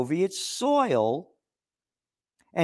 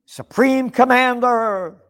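drawn-out mocking Supreme Commander, higher and louder; sarcastic title; 8 semitones higher, 8 dB louder, slower than usual. Real, not acted. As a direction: sneer at the grand title, slowly.

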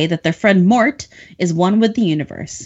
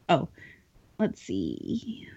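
whispered aside; 7 semitones lower, 14 dB quieter, much slower than usual. Real, not acted. Muttered aside while reading notes.

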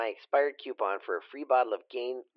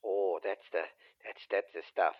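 usual speaking voice

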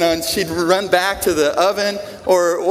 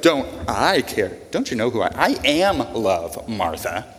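defensive reply; 7 semitones lower than usual. Retold or imitated. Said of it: "Jesus could be like".

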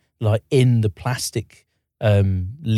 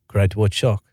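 put-on South African accent; 3 semitones lower than usual. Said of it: "in a South African accent".

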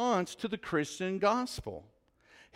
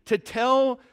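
higher-pitched, insistent appeal; urgent Moses questioning God; 7 semitones higher, 4 dB louder than usual. Retold or imitated. Moses asks God for his name, urgently insistent. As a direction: press the demand in a raised voice. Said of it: "Moses is asking God".

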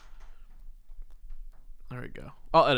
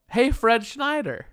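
high, drawn-out hollered game title; 8 semitones higher, much slower than usual. Acted. Announcing the game's name to the guest, campy singer impression. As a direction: stretch the call out high. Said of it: "this is a game called".

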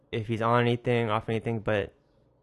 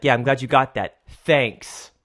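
snide thanks; 6 dB louder, slower than usual. Retold or imitated. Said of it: "He's like".

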